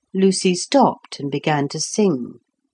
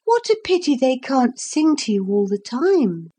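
put-on little girl voice; 7 semitones higher than usual. Lucy's voice in the story.

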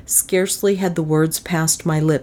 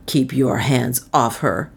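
low, slow, controlled menace; lowered voice, slow and mildly fierce; restrained fierce warning; 5 semitones lower, slower than usual. A narrator's reading. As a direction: warn them off with understated ferocity. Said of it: "said Northmore fiercely".

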